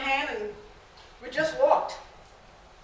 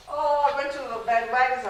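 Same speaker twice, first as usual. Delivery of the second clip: usual speaking voice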